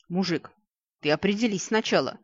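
ordinary delivery

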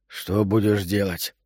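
low, slow, subdued hoarseness; hoarse question. A narrator's reading. Husband asks the doctor his plan, low hoarse voice. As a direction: ask it low and husky. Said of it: "хрипло спросил Илья".

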